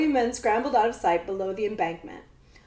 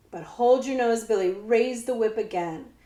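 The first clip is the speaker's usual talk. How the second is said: ordinary delivery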